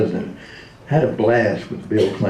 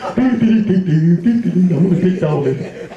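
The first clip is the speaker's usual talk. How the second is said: Clem Cadiddlehopper voice; 6 semitones higher, much slower than usual. Retold or imitated. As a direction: stretch it out like a bumbling country rube. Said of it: "I thought he was Clem Cadiddlehopper".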